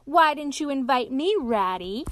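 hearty mock complaint. A narrator's reading. Otter chiding Ratty for no invitation, loud and hearty. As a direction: protest loudly to an old pal.

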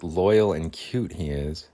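usual speaking voice

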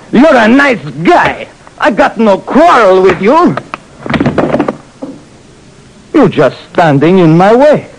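put-on high, slow accented strongman voice; proud giant showing off; burly giant Louis, boastful. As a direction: boast like a big friendly brute.

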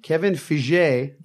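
"Feige" slowly said fee-ZHAY; slow, Frenchified "Feige", fee-ZHAY; mock-French pronunciation. Host adopts the joke version, "Feige" slowly as fee-ZHAY.

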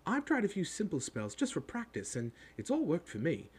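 high, rapid schoolgirl chatter; bossy girl talking in a rush; very fast. A narrator's reading. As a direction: rattle it off like an eager know-it-all. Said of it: "She said all this very fast".

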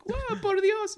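very high, loud comic gasp; mock amazed exclamation. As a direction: overplay the shock. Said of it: "It's just like".

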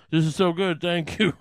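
bright, loud, gushing thanks for the wafer. Pretend. Comedian mocking churchgoer, gushing over the communion wafer. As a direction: rave about the Eucharist like a treat. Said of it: "you're not supposed to go".

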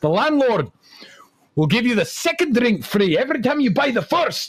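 high-pitched, badly faked Scottish accent; terrible Scottish accent. Comic boasting as the Scotsman in a joke, terrible Scottish accent. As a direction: ham up a dreadful Scottish accent. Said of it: "That is the worst Scottish accent".

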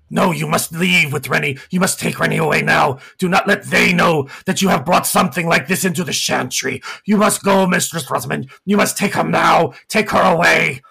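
a frantic servant begging his mistress to flee; urgent gargoyle. Acted. Gargoyle Rex urging his mistress.

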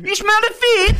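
very high, loud mock gangster voice; Top Boy audition. Actor auditioning for casting agent, squeaky hammed-up gangster voice. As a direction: overact the street slang in a squeal. Repeats his one audition line.

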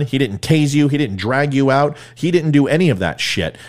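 usual speaking voice

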